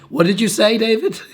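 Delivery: squeaky high confused asking; imitating a coworker who can't hear; high mock puzzled coworker. Coworker at work asking him to repeat, high puzzled voice. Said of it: "they're like".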